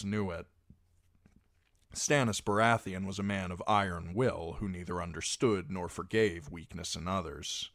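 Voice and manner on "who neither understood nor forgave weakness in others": usual speaking voice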